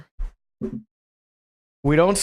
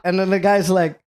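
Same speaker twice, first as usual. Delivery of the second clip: usual speaking voice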